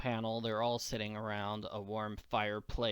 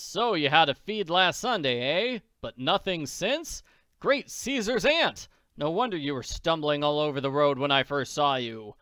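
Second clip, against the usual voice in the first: louder, booming fatherly voice; a big-hearted millionaire taking charge; hearty Daddy Warbucks; 3 semitones higher, 7 dB louder than usual. Acted. Warbucks tells Annie he'll soon fix her hunger, loud and hearty.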